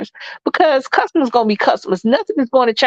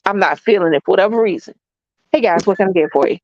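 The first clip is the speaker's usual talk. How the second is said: lower, unsmiling server greeting, polite but dry; just doing the job, no sparkle; not feeling fluffy; 4 semitones lower than usual. Shown as a demonstration. Server greeting customers on an off day, flat polite. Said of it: "And this is my".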